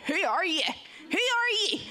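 high-pitched mimicry of outsiders attempting a Northern Irish accent; imitated Northern Irish accent. Shipmates copying her mantra, mock Northern Irish accent. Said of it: "trying to do our accent".

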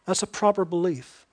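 ordinary talk

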